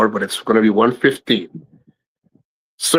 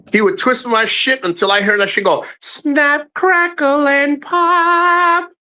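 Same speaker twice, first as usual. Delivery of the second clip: mock cracking sound; 12 semitones higher, slower than usual. Retelling ear being twisted.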